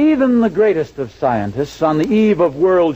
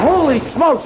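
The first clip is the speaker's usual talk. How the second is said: high startled holy smokes; surprised exclamation; 11 semitones higher than usual. Acted. Husband waking to a foggy room, surprised. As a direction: blurt it out in surprise. Sees the fog at morning.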